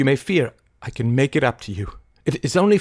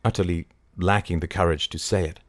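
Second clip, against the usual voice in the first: plain reading voice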